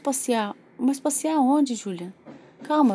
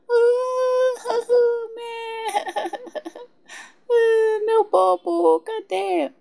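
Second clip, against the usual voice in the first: put-on crying baby; 10 semitones higher, much slower than usual. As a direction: wail like a fussy baby. Pretend baby crying for something. (choro de bebê fingido)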